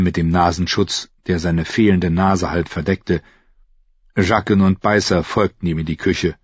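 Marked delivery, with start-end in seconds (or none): none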